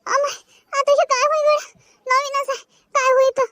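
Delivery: high, worried cry; lost and scared; frightened wail. Acted. Panicked alone in jungle. (घाबरलेला आक्रोश)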